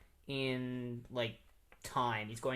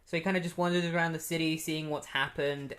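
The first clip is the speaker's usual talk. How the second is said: usual speaking voice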